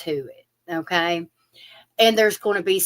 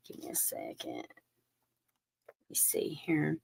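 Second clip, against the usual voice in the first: unvoiced, soft, to herself; whispered aside; 3 semitones lower, 14 dB quieter than usual. Real, not acted. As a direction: whisper to yourself while shuffling. Shuffling deck, muttering aside.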